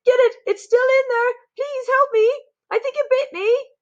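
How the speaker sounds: very high, loud panicked shriek; frantic shouting. A narrator's reading. Stupid cook still shouting about the mouse, frantic. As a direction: shriek in desperation. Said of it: "the stupid cook still shouting".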